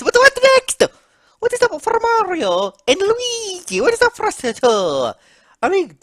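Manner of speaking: high, theatrical character lament; despairing Mario. Acted. Mario asking what awaits the two brothers, despairing. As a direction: wail about fate in a high voice.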